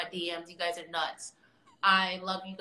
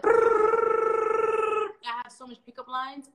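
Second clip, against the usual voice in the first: showing off; 12 semitones higher, 6 dB louder, much slower than usual. Acted. Bragging about pickup lines.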